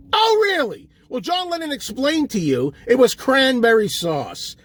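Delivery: ordinary talk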